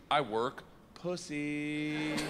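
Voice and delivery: slow, stretched last word; drawn-out translation. Acted. Comic translating his Russian sentence, slowly drawn out. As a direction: draw out the final word slowly.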